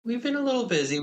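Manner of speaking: playful high echo of the line; high-pitched echo. Pretend. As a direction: pitch it way up, teasing. Partner echoes the line back.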